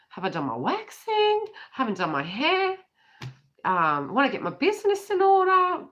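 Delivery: high-pitched, hammed-up worry; mock fretting. Voicing someone going through a purge, mock fretting.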